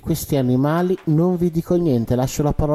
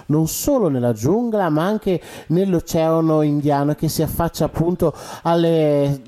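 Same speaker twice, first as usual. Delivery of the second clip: ordinary talk, nothing marked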